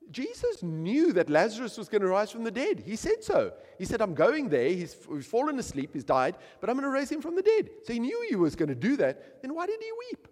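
high, loud preacher's build; impassioned preaching. Acted. Preacher pressing why Jesus wept, loud and high. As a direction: press the question on the crowd.

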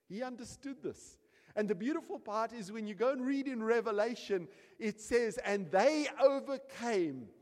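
loud, high, driving sermon delivery; fiery preaching. Acted. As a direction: preach it out with full force. Preaching at full force.

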